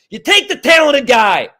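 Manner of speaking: loud shout hammering take; fired-up shout. Real, not acted. Host yelling draft advice at the Packers GM, fired-up shout. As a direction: yell it like the last straw. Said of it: "I'm all fired up".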